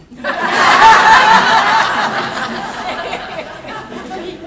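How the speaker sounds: high, loud burst of laughter; big delighted laugh. Real, not acted. Speaker laughing heartily at Billy's proud words about Alateen.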